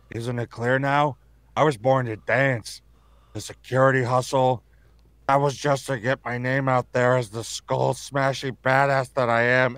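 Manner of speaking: slow, strangled delivery; squeezed throat. Shown as a demonstration. Actor reading lines with hand on throat, squeezed voice. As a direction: speak as if a hand grips your throat. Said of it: "I have my hand on my throat right now".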